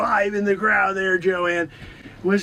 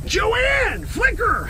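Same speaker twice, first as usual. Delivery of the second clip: high shout across the room; calling out; 13 semitones higher, much slower than usual. Acted. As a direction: summon the assistant urgently. Calling to assistant.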